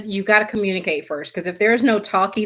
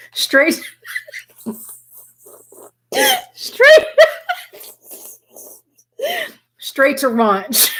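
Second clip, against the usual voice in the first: laughing; 9 semitones higher, much slower than usual. Real, not acted. Reading cards to viewers, cracking up at the punchline. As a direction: force the words out through giggles. Reader laughing at raunchy card image.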